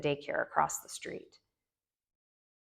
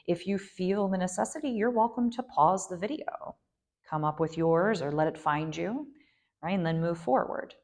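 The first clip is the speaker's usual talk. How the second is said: ordinary talk, nothing marked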